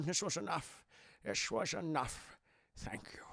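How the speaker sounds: mild sad murmur. Retold or imitated. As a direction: let it fade to almost nothing. Sean Connery looking sad.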